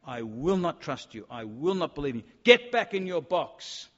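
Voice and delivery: emphatic refusal, building to a loud dismissal; defiant refusal. Acted. Acting out contemporaries rejecting Jesus.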